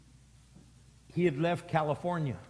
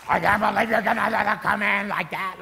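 high, loud scandalized protest; mock outraged church member; 6 semitones higher, 10 dB louder than usual. Pastor mocking judgmental members, high and loud. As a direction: ham up the shocked churchgoer.

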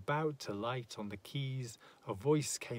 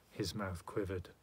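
ordinary delivery, nothing marked

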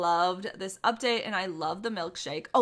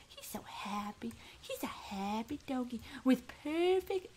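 He's so happy whispered, no voice; 7 dB quieter than usual. Real, not acted. Same words, now whispered.

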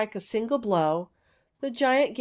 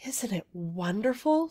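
plain reading voice

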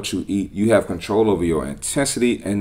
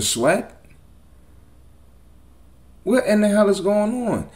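high-pitched disbelief; shocked, what are you doing; incredulous; 9 semitones higher than usual. Real, not acted. Outraged reaction to weight loss trend.

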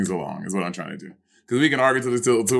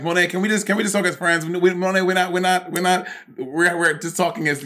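higher-pitched, insistent re-enacted appeal; imitated plea; 7 semitones higher than usual. Co-host acting out an appeal to talk as friends, pleading. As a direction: plead it in stop-start repeats, pitched up.

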